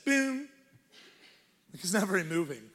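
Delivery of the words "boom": short, high sung boom; staccato note. Shown as a demonstration. Showing the church a staccato note, short high boom. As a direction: imitate one brief piano note. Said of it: "it just does this".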